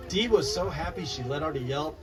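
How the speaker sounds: plain reading voice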